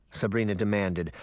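ordinary delivery

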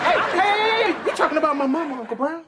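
mock offended protest. Nephew defends his mother.

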